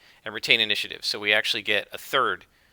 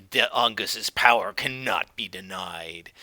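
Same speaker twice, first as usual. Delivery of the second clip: slow, ominous cultist voice; fanatic zealot; 3 semitones lower than usual. Acted. Cult leader defying Wiglaf, slow and ominous. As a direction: intone it like a true believer.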